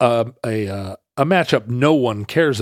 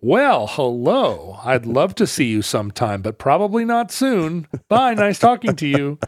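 mock-polite newcomer, pitch climbing as it goes; accent-free politeness; 3 semitones higher than usual. New Yorker turned Seattleite brushing someone off, mock polite. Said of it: "immediately lose his accent".

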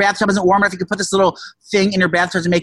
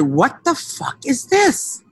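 baffled; 7 semitones higher, much slower than usual. Retold or imitated. Baffled by strange deliveries.

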